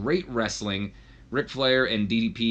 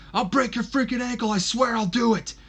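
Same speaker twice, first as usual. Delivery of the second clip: high, loud furious scream; screaming threat; 14 semitones higher, 3 dB louder than usual. Acted. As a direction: scream it at him across the ring. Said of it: "you can just hear Kurt screaming at him".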